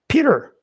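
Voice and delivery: Peter called out high and loud. Shown as a demonstration. Teacher calling a student's name to address him. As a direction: call out Peter to get his attention. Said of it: "or in the beginning".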